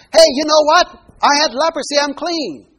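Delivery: high, loud eager announcement; bursting to tell everyone; ex-leper blurting out his news to passers-by, thrilled; excited healed man. Acted.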